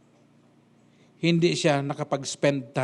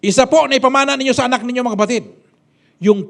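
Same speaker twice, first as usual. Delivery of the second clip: higher, rushed exhortation; loud, fast preaching; 7 semitones higher, 8 dB louder than usual. Acted. Preacher urging parents in the congregation, loud and fast.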